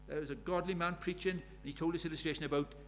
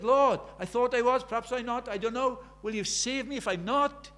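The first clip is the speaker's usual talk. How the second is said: desperate prayer; 6 semitones higher, 6 dB louder than usual. Retold or imitated. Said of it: "desperate, absolutely desperate".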